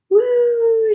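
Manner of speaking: high, loud whoop; excited scream. Acted. Imagining her on the ride screaming, excited scream. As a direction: let out a roller coaster "woo". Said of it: "She's screaming".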